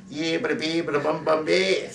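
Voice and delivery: high, chanted nonsense in a mock politician voice; Ted Kennedy. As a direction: parody a Boston accent in gibberish. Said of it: "she'll be sounding just like Ted Kennedy".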